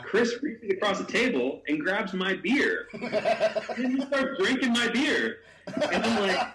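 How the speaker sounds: slow, high and laughing through it; can barely get it out for laughing; laughing while telling. Real, not acted. Telling the host how Chris grabbed his beer, laughing.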